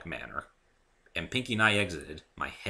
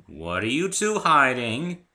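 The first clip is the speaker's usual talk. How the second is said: banteringly suspicious; 6 semitones higher, 8 dB louder, slower than usual. A narrator's reading. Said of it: "in a banteringly suspicious way".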